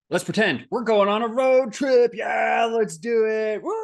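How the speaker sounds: shouting high and loud with excitement; high, loud, overjoyed shout; hyped-up. Pretend. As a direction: pump the room up like kicking off a road trip. Hyping up a pretend road trip.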